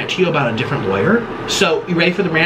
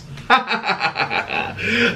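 long staccato cackle; gleeful cackle; 3 semitones higher, 4 dB quieter than usual. Pretend. Vlogger relishing spicy lava sauce, gleeful cackle. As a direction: cackle in a rapid, drawn-out run.

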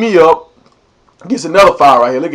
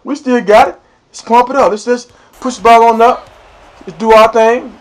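rallying teammates; 6 semitones higher than usual. Retold or imitated.

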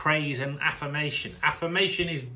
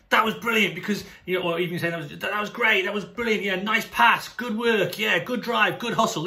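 high, keen sideline praise; gushing coach praise; 5 semitones higher, 3 dB louder than usual. Shown as a demonstration. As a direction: cheer them on from the touchline. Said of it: "So instead of saying".

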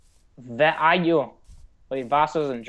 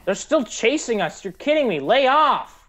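exasperated whine; 7 semitones higher than usual. Real, not acted. Mobs chasing them.

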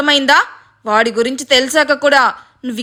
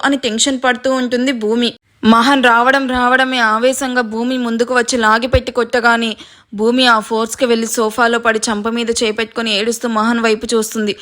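plain reading voice

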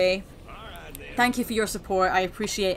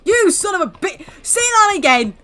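high, loud indignant yell; outraged; 11 semitones higher, 11 dB louder than usual. Pretend. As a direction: yell a furious challenge at the insulter. Insulted by game character.

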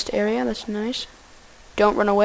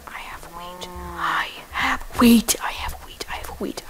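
whispered luring; 3 semitones lower than usual. Real, not acted. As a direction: whisper to tempt the cows.

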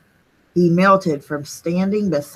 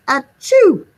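big loud high sneeze; giant sneeze; 7 semitones higher, 6 dB louder than usual. Acted. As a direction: sneeze it out hugely. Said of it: "sneezed a gigantic".

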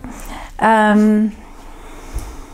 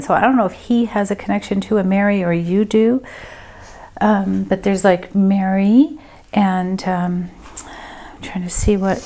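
usual speaking voice

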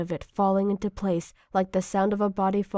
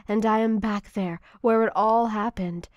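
ordinary delivery

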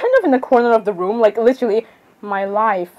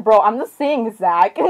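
ordinary delivery

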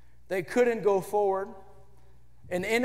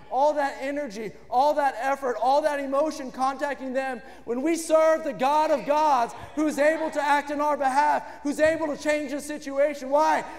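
full-force preaching; 5 semitones higher than usual. Acted. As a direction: lift the room, proclaiming God above all. Preacher building to climax.

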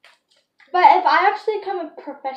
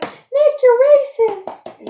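high, loud accusing yell; mock group yell, slow and drawn out; kids yelling at a friend; 11 semitones higher, 9 dB louder, much slower than usual. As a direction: call him out at full volume. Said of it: "everybody was yelling".

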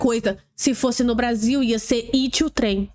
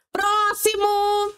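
higher, slow, drawn-out call; calling out next; 8 semitones higher, much slower than usual. Real, not acted. Dismissing the clip and calling the next, a drawn-out call. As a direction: stretch the word like calling the next in line.

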